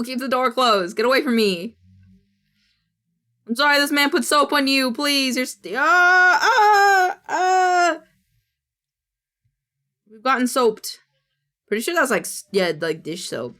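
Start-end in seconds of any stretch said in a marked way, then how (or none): 5.8-8.0 high, loud fake sobbing ahs; hammed-up crying noises; mock whimpering cry. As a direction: whimper like a wounded pet. Pretend crying over soaped cat.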